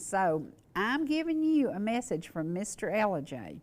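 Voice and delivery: normal talking voice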